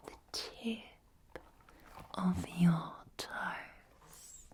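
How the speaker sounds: slow Northern Irish ASMR whisper, sing-song; whispering Northern Irish accent, slowly. Acted. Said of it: "videos in a Northern Irish accent".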